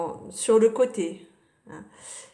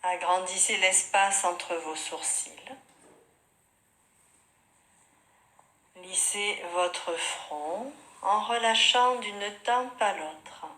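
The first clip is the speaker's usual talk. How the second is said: softer, unhurried yoga nidra guidance with long pauses; guided meditation; 4 dB quieter than usual. Real, not acted. Yoga teacher guiding students lying on their mats, calm meditation voice.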